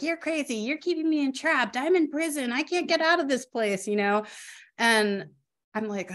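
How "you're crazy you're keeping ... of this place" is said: put-on higher, agitated voice of a confused elderly mother; imitating mom. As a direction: act out the mother protesting she's trapped. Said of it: "And she's like".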